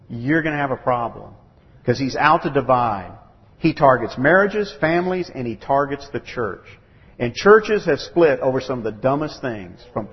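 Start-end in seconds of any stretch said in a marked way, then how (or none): none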